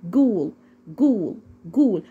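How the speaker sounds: "جول" starting with a hard g; spaced model repeats of "جول". Shown as a demonstration. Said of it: "حرف الج بالظبط".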